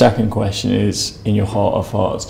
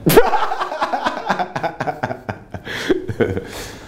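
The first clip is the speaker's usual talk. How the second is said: laughing; 7 semitones higher than usual. Real, not acted. Laughs at own compliment.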